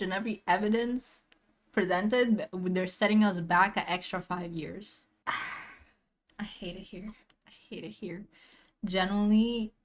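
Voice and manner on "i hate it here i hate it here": sigh, then a near-whisper; very soft, drained despair; defeated murmur. Real, not acted. Viewer despairing over the news, defeated near-whisper. Despairing aside after the rant.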